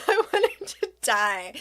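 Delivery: real laugh into the words. Real, not acted. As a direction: laugh at your own mortification. Laughing at her own embarrassment.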